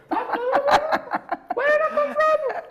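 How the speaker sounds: high burst of laughter; laughing. Real, not acted. Reacting to her own remark.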